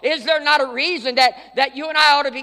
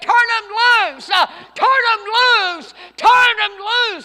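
loud, higher, slow yelling; screaming crowd; 9 semitones higher, 6 dB louder, slower than usual. Retold or imitated. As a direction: scream it at him from the roadside. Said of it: "They were screaming".

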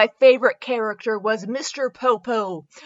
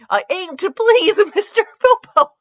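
laughing read; 8 semitones higher, 6 dB louder than usual. Acted. Reading absurd fanfic line.

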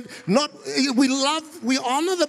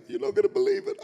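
high-pitched imitation of a panicked wife; high, urgent mock alarm; 11 semitones higher, faster than usual. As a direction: mimic a wife breaking shocking news. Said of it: "she rings me in a panic".

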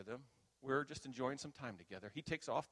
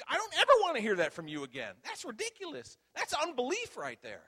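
loud, high-pitched mock tirade; unrestrained rebuke; 14 semitones higher, 12 dB louder than usual. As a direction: imitate a mentor coming after someone, no holding back. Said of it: "when he got going, he wasn't holding back".

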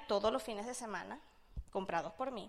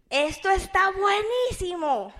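overheard excitement; 5 semitones higher, 8 dB louder, slower than usual. Retold or imitated. Overheard excited voice through door.

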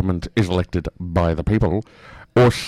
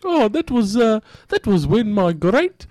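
high put-on voice, recollecting haltingly; [name]; 13 semitones higher than usual. Caller bluffing through a history question, high comic voice. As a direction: piece together an answer in a comic disguise.

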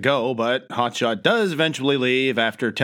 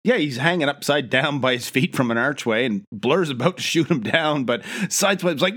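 ordinary talk, nothing marked

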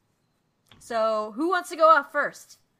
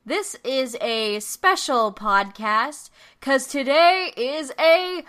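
announcer; 6 dB louder, much slower than usual. Acted. Announcing the fight.

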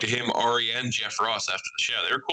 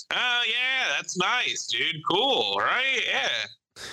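high, forced polite enthusiasm; polite fake enthusiasm; 9 semitones higher, much slower than usual. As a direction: humor the fan politely. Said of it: "watch Big J just be like".

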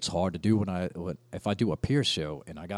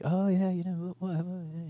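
put-on British accent; 9 semitones higher than usual. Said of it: "you don't have to do the accent".

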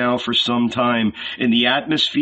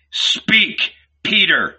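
high, sharp demand; angry outburst on each word; angry command; 7 semitones higher, 3 dB louder, much slower than usual. A narrator's reading. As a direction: order him to answer, pitched well up. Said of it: "he repeated angrily".

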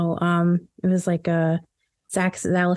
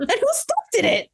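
pitched way up, mock disgust; high mock outrage. Friend voicing a party guest, squealing in mock disgust. Acted follow-up line.

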